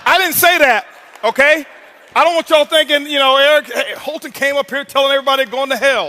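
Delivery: loud, high-pitched joking disclaimer; comic outcry to the room, pitched well up; mock protest. Real, not acted. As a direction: raise the voice in playful self-defence. Jokingly disowns the crowd's answer.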